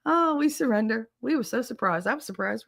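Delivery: high, fast, mock-alarmed soldiers; enemy soldiers ambushed by paratroopers in makeup; startled soldiers. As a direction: yelp it like spooked troops. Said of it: "they're just like".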